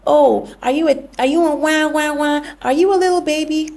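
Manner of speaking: put-on high, sing-song baby-talk taunting; mocking taunt. Parent taunting a crying son, mocking baby voice. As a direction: jeer at him like a playground bully.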